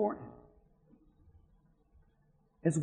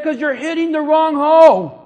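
loud, higher shout of a corrector; old man yelling correction; 8 semitones higher, 13 dB louder than usual. Retold or imitated. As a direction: bark the fix across the course.

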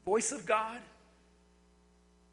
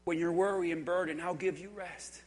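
low, soft and tender invitation; voice of God; 7 semitones lower, 8 dB quieter than usual. Acted. Preacher voices God inviting the weary, low and soft. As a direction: speak low and gently to comfort. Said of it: "The voice of God says".

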